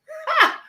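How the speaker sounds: single high, loud burst of laughter; quick amused "ha!"; short laugh. Real, not acted. Amused by a chat greeting.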